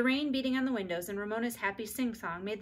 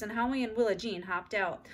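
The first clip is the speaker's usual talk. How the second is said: ordinary delivery, nothing marked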